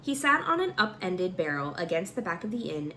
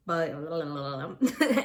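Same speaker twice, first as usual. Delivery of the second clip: short laugh at own slip; embarrassed laugh; 2 semitones lower than usual. Real, not acted. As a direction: laugh at the misreading.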